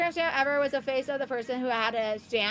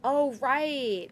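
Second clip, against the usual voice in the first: ordinary talk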